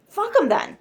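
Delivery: sudden high, loud curse; angry outburst. Real, not acted. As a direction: blurt it out, fed up on their behalf. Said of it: "My shadow does not like a bully".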